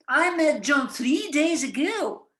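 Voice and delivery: slow and firm, landing on the time; stress on three days ago. Shown as a demonstration. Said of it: "It's not yesterday".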